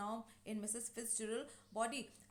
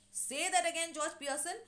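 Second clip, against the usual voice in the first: louder, higher mock threat; retold angry challenge; 6 semitones higher, 6 dB louder than usual. Wife starts up at her husband, mock angry challenge. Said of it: "she starts up and says".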